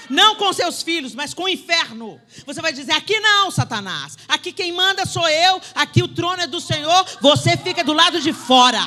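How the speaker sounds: loud, high, forceful command; shouted rebuke. Pretend. Preacher voices a believer rebuking Satan, shouting. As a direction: drive the devil out at full voice.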